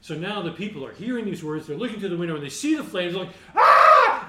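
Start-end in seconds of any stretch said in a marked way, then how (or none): 3.6-4.2 sudden shrieking scream, very high and loud; panicked scream. Retold or imitated. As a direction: scream out in terror at the flames. Crowd sees the fire.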